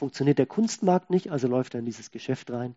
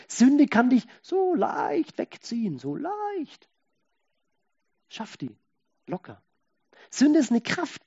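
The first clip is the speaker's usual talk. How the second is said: preaching; 6 semitones higher, 4 dB louder than usual. Acted. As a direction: preach it hard, leaning on Sünde. Intense sermon passage. (eindringlich predigend)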